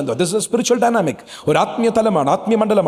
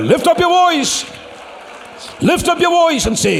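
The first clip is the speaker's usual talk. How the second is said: fervent exhortation; 10 semitones higher, much slower than usual. Real, not acted. Pastor urging the congregation to shout, high and fervent. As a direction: rouse the church to lift their voices. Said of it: "ശബ്ദമുയർത്ത്".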